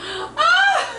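a real shocked yelp that breaks into laughter; startled gasp. Real, not acted. Shock while drinking, laughing and crying out.

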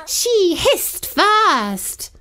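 high, slow whiny meow; meowed protest. Acted. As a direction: stretch it out like a meowing cat. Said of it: "I meowed back".

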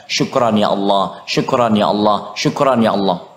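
low, slow devotional thanks; grateful murmured prayer. Shown as a demonstration. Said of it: "jangan pelit untuk mengucapkan".

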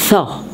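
Thaw. with a breathy voiceless th at the start; Thaw. starting with air only, no voice on the th; breath-only th in Thaw. Shown as a demonstration. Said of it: "you pronounce the T H with like a breath".

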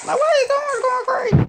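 loud, very high-pitched squeal; real high, loud exasperation; squealed disbelief. Real, not acted. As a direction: shriek it in disbelief at the play. Reacting to a play in game.

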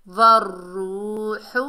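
slow वर्रूहु, holding the doubled r into its vowel; elongated recitation. Shown as a demonstration. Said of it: "एक अलिफ की मिकदार खींच कर".

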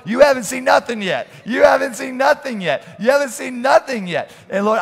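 building from loud to shouting; preaching at full force. Real, not acted. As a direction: preach it up to a roar.

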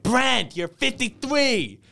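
high-pitched hammed-up shout-out; imitated over-the-top celebrity video message. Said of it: "I'm probably gonna explain this horribly".

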